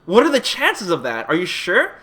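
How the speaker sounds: can't believe the odds, voice jumps up; shocked disbelief. Retold or imitated. Disbelief at second faulty LED.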